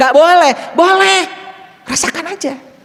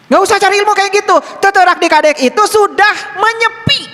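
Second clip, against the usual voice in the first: high, loud, ringing sermon voice; full-force preaching; 4 dB louder than usual. Acted. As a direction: proclaim it loudly to the congregation.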